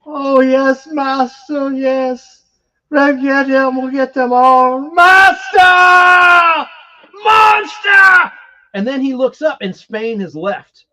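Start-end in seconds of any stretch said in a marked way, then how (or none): 0.1-4.9 higher pitched, devoted pledge to the master; entranced henchman. Retold or imitated. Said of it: "He's like". 4.9-8.3 very high, very loud screamed cry; possessed shout. Retold or imitated. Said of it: "He's like".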